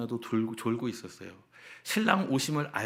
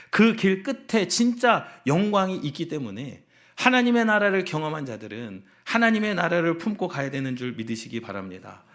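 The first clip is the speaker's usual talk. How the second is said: impassioned preaching; 5 semitones higher, 6 dB louder than usual. Real, not acted. Pastor promises glory at the road's end, impassioned preaching.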